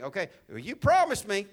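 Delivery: loud, high pleading prayer; David pleading. Retold or imitated. Pastor voicing David praying to God, loud and pleading. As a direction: voice David's urgent plea to God. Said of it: "He's remembering".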